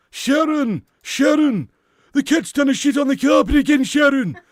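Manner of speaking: high, loud yell calling Sharon; hammed-up domestic bellow; high shout. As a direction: holler across the house, high and loud.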